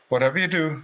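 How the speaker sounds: retold advice in a Cork accent. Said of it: "he said, in his Cork accent".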